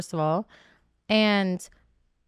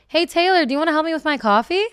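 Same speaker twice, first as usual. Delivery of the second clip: bright, higher, louder call across the room, meant to be overheard; purposely loud; 7 semitones higher, 4 dB louder than usual. Retold or imitated. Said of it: "I'm purposely saying out loud".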